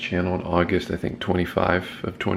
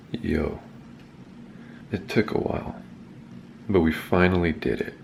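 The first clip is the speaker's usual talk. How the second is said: hoarse just-woke-up voice; croaky morning voice, soft; scratchy voice; 3 semitones lower, 7 dB quieter than usual. Real, not acted. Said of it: "don't mind my scratchy voice".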